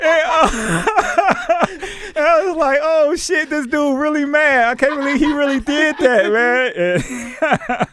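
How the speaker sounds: high, loud, talking through laughter; laughing. Real, not acted. Telling his friend about the cat, laughing and loud. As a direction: still cracking up as he tells it.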